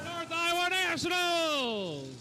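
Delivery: big booming drawn-out hype call; arena announcer shout. Real, not acted. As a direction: belt it out to the whole arena. Arena announcer hyping crowd.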